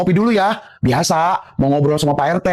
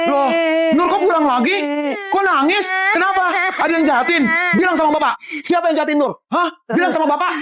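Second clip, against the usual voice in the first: high, anxious father; worried questioning; 9 semitones higher than usual. Acted. Father asking who hurt his child, alarmed.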